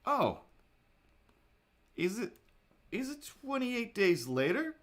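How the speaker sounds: high, startled "is it twenty-eight days later?"; mock alarm. Vlogger joking about an empty street, mock alarm. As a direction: gasp in pretend horror at the empty street. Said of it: "I'm just like".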